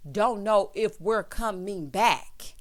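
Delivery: drawn out slowly, each word weighted; slow emphatic refrain. Real, not acted. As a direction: let it land slow and heavy as the refrain.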